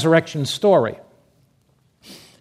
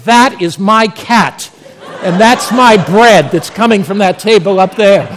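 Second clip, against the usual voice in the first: loud, raised, emphatic outburst; indignant captain; 3 semitones higher, 8 dB louder than usual. Acted. Captain spotting his own cat and bread, indignant. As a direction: punch each word in comic outrage.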